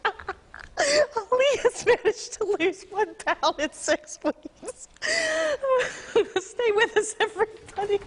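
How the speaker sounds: anchor stifling giggles. Real, not acted. Anchor cracks up reading story.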